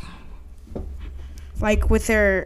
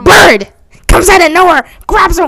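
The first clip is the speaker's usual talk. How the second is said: loud, higher burst of narration; sudden excited shout; 7 semitones higher, 9 dB louder than usual. Acted. Storyteller springs a random bird on the tale, excited shout. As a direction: shout it like a surprise attack.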